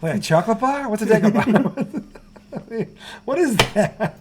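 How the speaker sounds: high, laughing through the words. Real, not acted. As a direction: giggle while asking. Laughing at the silly name.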